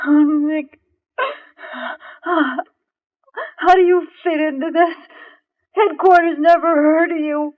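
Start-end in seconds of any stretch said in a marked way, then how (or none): 0.1-5.0 tearful relief. Acted. Heroine relieved, tearful reunion.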